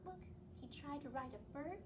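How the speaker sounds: ordinary delivery, nothing marked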